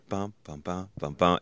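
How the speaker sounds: soft, even bum sounds tapping out a rhythm; iambic beat. Shown as a demonstration. Explaining iambic pentameter to a friend, softly beating the rhythm. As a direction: quietly drum out the meter. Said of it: "it's rhythmic".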